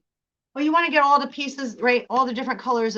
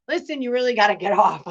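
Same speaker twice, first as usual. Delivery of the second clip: mock hurried parent; faster than usual. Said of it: "And it's like".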